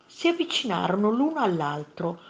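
plain reading voice

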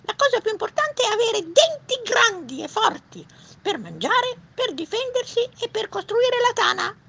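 very high, loud beaver squeak; exclaiming beaver. Acted. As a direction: refuse firmly in a tiny beaver voice. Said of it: "esclamò il castoro". (castoro, esclamazione con vocina acuta)

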